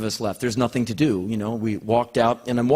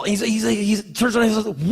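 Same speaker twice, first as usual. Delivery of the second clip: ordinary delivery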